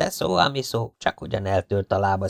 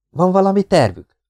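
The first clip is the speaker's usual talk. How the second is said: eager question; 9 semitones higher, 5 dB louder than usual. Acted. Characters plotting an escape.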